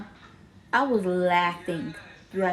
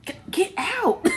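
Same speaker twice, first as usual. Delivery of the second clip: high mock scolding; 10 semitones higher than usual. Mama pulling her out.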